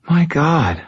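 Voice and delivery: put-on high, distressed girl's voice; tearful distress. A narrator's reading. Injured girl remembers the attack, tearfully distressed. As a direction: pitch it high, close to tears. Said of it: "Tears welled from her eyes".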